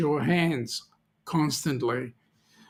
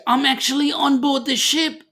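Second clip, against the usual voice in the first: high, thrilled passenger voice; giddy, raised-pitch exclamation; acted excitement; 9 semitones higher than usual. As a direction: squeal it like a first-time cruiser. Voicing a thrilled new passenger.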